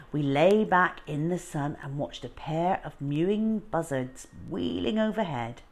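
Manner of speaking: ordinary delivery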